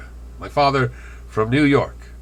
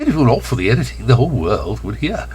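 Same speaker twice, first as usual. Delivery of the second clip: louder, quicker slip into Bronx accent; slipping accent; 4 dB louder than usual. Shown as a demonstration. Speaker exposing unedited speech, in a slipped Bronx accent. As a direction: let the Bronx accent break through. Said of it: "where my accent slips".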